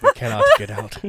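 loud, playful doom quote; mock-dramatic declaration. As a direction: call out the grim line over the laughter. Same ominous line over laughter.

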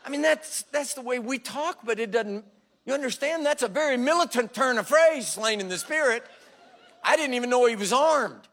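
high, loud, laughing through the joke; laughing aside. Real, not acted. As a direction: chuckle as you explain the phrase. Laughing at the phrase.

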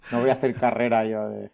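ordinary talk, nothing marked

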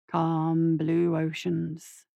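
very slow, low, calming drawl; soothing. Acted. Doctor steadying his anxious nurse, slow and soothing. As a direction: stretch the words out to lull someone.

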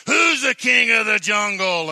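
high, loud bullying lion roar; roaring lion challenge. Acted. Lion struts up to the monkey, roaring challenge. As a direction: bellow it like a swaggering lion. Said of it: "and he says".

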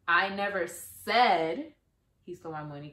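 said drawn out with a rise, very slow; slow build to a rising said; stress on SAID. Shown as a demonstration. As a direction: hang on the word said. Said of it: "the words that you stress".

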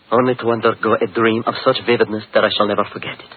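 ordinary talk

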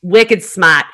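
"smart" as "smaht", Boston style; playful Boston echo, dropping the R in "smart"; Boston accent. Acted. Echoes cohost's put-on New England saying.

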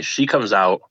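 ordinary talk

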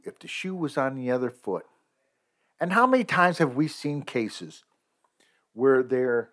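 normal talking voice

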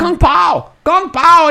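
high mock yelling across the room; imitating yelling. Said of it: "they're like".

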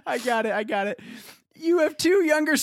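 ordinary talk

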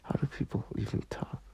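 fast unvoiced whisper to herself. Real, not acted. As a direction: murmur it quickly under your breath.

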